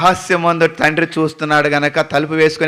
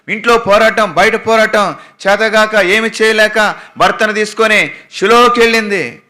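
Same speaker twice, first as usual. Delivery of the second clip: high, loud, driving sermon; full-force Telugu sermon; fervent preaching; 8 semitones higher, 5 dB louder than usual. Acted. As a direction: preach it with rising fervour.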